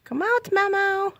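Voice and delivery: high slow sing-song coax; pet coaxing voice. Acted. Coaxing cat out.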